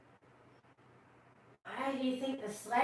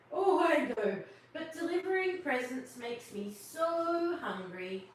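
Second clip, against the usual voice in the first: a lightly voiced, louder Santa; 6 semitones higher, 4 dB louder than usual. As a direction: answer as Santa, a touch sheepish.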